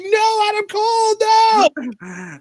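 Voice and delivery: very high, hammed-up panicked wail; mock freak-out. Imitating the faction panicking over Adam Cole, mock freak-out. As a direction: squeal in exaggerated horror. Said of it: "freaks out. They're just like".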